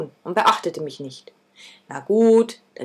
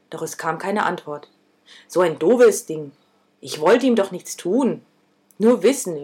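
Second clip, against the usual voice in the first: ordinary delivery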